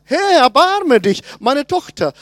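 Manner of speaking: loud retold plea for her daughter; crying out. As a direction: call out for mercy. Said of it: "Sie schreit".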